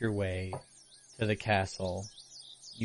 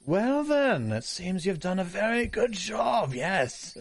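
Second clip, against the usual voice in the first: arrogant, higher, self-satisfied nobleman; pompous voice; 7 semitones higher than usual. Acted. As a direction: speak down to them, pompous and smug. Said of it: "a very arrogant sounding and pompous voice".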